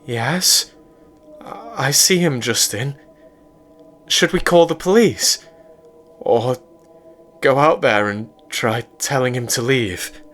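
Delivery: scared and asking what to do; scared girlfriend. A narrator's reading. April scared, asks about police.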